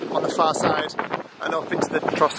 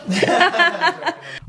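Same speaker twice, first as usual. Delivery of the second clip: high laugh; 13 semitones higher than usual. Real, not acted. Laugh after van joke.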